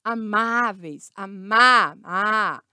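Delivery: Amáveis slowly, with a long open á; a held wide open. Shown as a demonstration. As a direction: stretch the má in Amáveis, then isolate a. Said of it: "Então, a aberto".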